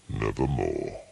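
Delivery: low, hollow raven voice; a dark low croak of a word; raven's croak. A narrator's reading. The raven answers the narrator, low and hollow.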